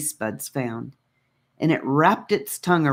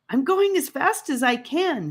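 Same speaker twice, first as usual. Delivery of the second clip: higher, strained snappish reply; retort; 10 semitones higher than usual. Acted. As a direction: push back at his sister. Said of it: "he retorted".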